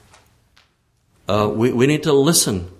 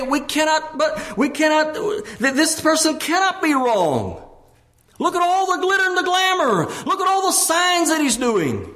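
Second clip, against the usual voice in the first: very high, chanting preacher's imitation; mock-awed believer at a high pitch; dazzled follower. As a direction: cry it out high, as if starstruck. Preacher voices dazzled followers of prophet.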